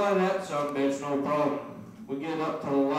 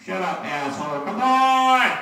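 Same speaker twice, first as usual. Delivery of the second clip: screaming much higher and louder at the light; full furious yell at a red light; road rage fit; 8 semitones higher, 8 dB louder, slower than usual. Retold or imitated. Buddy screaming at the red light, furious. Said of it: "he keeps going".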